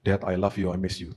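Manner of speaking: low, soft, sincere declaration; understated, hushed tenderness to a father; grown children's affection. Retold or imitated. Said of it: "Waktu mereka bertumbuh dewasa".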